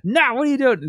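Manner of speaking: high, sharp mock yell at the kid; mock scolding. Dad imitating his wife scolding their son in the garage, high and sharp. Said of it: "and she's like".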